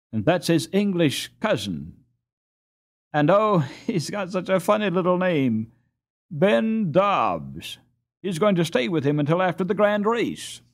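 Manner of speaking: put-on high, lively boy's voice telling news; a delighted boy sharing gossip, a little giggle in it; eager boy character. A narrator's reading. Master Vost telling the boys about the cousin, eager boy voice.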